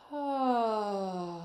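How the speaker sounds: loud sighing ah. Shown as a demonstration. Coach sighing out during a neck stretch. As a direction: breathe out a sigh. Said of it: "sigh it out".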